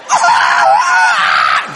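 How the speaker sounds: high-pitched mock wail; long put-on scream; imitated screaming. Preacher imitates wife screaming in church, high wail. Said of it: "Just a screaming".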